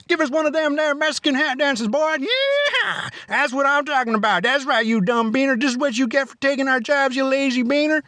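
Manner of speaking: high mocking bully voice, gloating; mocking ICE agent. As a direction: taunt him like a cruel agent forcing a dance. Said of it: "laughed at by ice agents".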